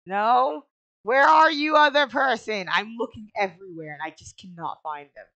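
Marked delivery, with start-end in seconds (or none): 1.0-2.7 loud, high, pleading shout; exasperated calling out. Real, not acted. Player calling the missing game character, exasperated shouting. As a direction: call out to someone you can't find.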